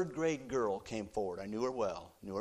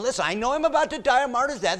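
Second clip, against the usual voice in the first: 1 Peter; 13 semitones higher, 11 dB louder, faster than usual. Acted. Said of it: "He's saying".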